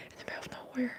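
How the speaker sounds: ordinary talk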